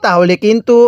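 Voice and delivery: ordinary delivery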